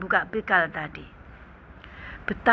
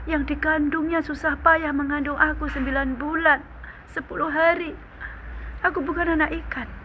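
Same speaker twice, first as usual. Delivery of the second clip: crying; 7 semitones higher than usual. Acted. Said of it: "Masih nangis".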